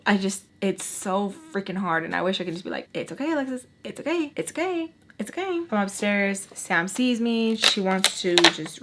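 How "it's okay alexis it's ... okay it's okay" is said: high, gentle reassurance climbing in pitch; self-soothing. Retold or imitated. Speaker wishing to comfort herself, high soothing voice. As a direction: coo it higher with each repeat.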